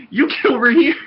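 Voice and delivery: high, loud mocking echo. Friend mimics the order, high and loud. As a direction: echo the order back in a squeaky mock.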